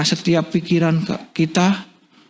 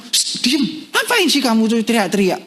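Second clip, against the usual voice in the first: shush, then a higher-pitched telling-off; hushing scolding; 6 semitones higher than usual. Acted. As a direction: scold a noisy man, mock annoyed. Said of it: "orang di sekelilingnya ngomong".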